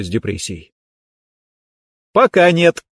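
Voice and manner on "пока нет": playful high tease, tongue out; teasing. A narrator's reading. Девушка дразнит жениха, высунув язык, игривый высокий голос. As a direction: tease him, a girl sticking her tongue out. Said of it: "высунула язык девушка".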